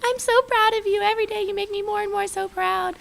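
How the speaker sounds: high, teary imitation of mom; tearful proud mom. Mom proud through tears, imitated high crying voice. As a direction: mimic mom weeping with pride. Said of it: "like, crying and shaking".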